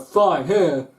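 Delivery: a sharp angry hand-over; huffy. Retold or imitated. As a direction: snap it out, fed up. Customer hands over the note.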